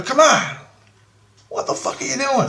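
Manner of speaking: high-pitched exasperation; retold high complaint; exasperated protest. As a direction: plead in indignant disbelief, pitched high. Complaining to Christ in retold plea.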